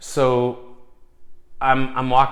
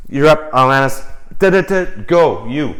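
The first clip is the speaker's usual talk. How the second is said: much louder, higher hype shout; cheering her on; 3 semitones higher, 9 dB louder than usual. Retold or imitated. Cheering [name] on during the drum circle, loud hype. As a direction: whoop her into her solo.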